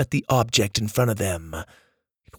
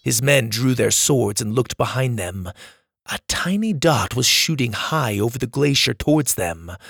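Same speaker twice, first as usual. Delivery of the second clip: plain reading voice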